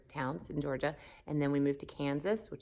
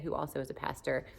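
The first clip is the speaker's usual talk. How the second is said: normal talking voice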